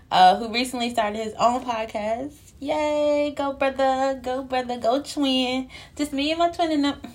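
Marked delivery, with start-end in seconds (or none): 2.6-7.0 excited cheer. Real, not acted. Cheering on brother's new podcast.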